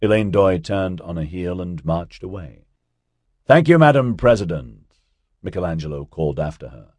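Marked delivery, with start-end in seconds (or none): 3.5-5.0 loud, higher, mock-courteous send-off. A narrator's reading. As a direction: call after her with mockery. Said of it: "a great deal of mockery in his voice".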